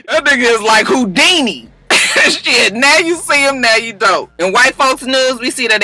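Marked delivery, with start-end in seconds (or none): none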